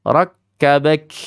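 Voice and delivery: Rakkabak with the final k half-released, wrong; wrong tawasud reading. Shown as a demonstration. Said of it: "Contoh ada yang baca tawasud ini".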